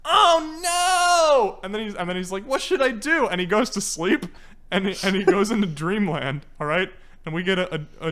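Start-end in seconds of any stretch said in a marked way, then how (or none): 0.0-1.6 high, loud, drawn-out wail; mock dismay. Acting out Cool Cat reading threat. 2.5-3.3 high-pitched helpless fretting; mock worry. Acting out Cool Cat's worry.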